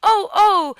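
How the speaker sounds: high, loud yelps; storybook child's cries of pain; crying out in pain. A narrator's reading. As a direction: yelp out three sharp ohs. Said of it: "Conrad cries out".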